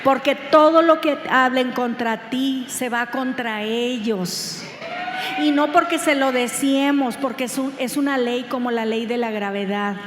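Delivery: predicando con fuerza, más fuerte; predicación a toda voz. Acted. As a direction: proclamarlo a la congregación con pasión. (predicadora exhortando con voz potente)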